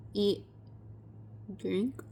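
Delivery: ordinary delivery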